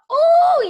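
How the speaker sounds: squealing high delighted ooh. Retold or imitated. Parent cooing over a baby's new teeth, squealing delight. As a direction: coo with joy at the baby's teeth.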